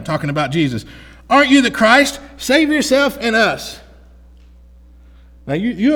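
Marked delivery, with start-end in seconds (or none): 1.3-3.8 mocking criminal. Criminal's taunt voiced in sermon.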